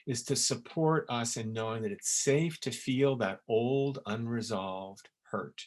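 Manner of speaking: ordinary delivery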